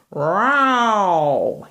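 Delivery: drawn-out yowling cat; a cat wailing from a branch. Acted. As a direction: yowl it like a stuck cat. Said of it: "he yowled".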